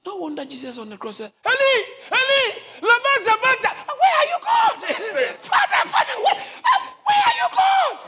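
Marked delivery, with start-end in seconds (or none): 1.4-8.0 anguished cry. Retold or imitated. Jesus's cry on the cross.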